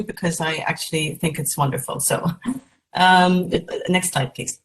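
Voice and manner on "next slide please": ordinary delivery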